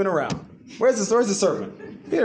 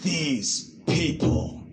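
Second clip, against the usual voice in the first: "These people" drawn out very slowly with disdain; scornful quote; 3 dB quieter, much slower than usual. Shown as a demonstration. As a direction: sneer "These people" stretched long and dismissive. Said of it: "people have stereotyped people".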